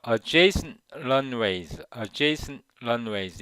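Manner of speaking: Adjacent runways said very slowly with the T swallowed; dropped T, slow demo. Shown as a demonstration. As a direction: drag out Adjacent runways and leave the T silent. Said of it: "이 T 발음이 거의 안 들려요". (T를 삼키고 아주 천천히 발음)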